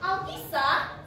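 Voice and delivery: ordinary delivery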